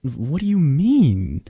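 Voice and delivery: ordinary talk, nothing marked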